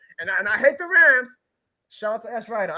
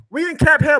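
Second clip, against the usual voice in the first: mocking; 6 semitones higher, 8 dB louder than usual. Mocking the media line.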